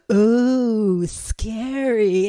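loud, drawn-out teasing fright; mock scared. Teasing friend about the bacon, loudly mock-scared. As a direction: make a big show of being spooked.

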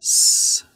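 "S" as a voiceless hiss, held alone; clear S sound. Shown as a demonstration. Teacher modelling the letter S, "S" as a clear hissed sound. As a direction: say "S" as just the unvoiced sss sound. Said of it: "pronounce it as a clear S".

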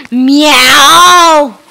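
loud, drawn-out yowl; upset cat meow. Pretend.

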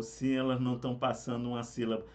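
usual speaking voice

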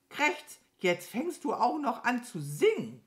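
hohe, zeternde Krähe; genervte Krähe. Acted. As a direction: sich schrill genervt empören. Krähe beschwert sich. (genervte Krähenstimme, hoch)

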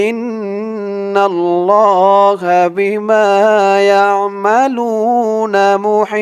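slow melodic Quranic recitation. Shown as a demonstration. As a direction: chant the verse with tajweed. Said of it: "আবার বলুন আমার সাথে". (ধীর সুরেলা কুরআন তিলাওয়াত)

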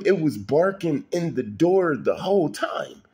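ordinary delivery

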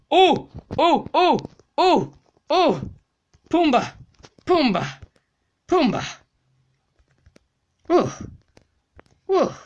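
high, loud excited shouts; high excited calls; excited yelps. Real, not acted. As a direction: cheer each hit. Excited gameplay outbursts.

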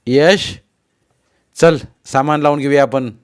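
calling out. A narrator's reading. Father calling his bewildered son to unpack, calling out. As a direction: call the child over to join in.

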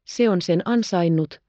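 usual speaking voice

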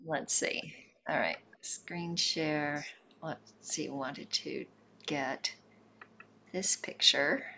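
soft whispered mutter under her breath; muttering to herself. Real, not acted. Host fixing the screen share, whispering to herself. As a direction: murmur to yourself while fiddling with the screen.

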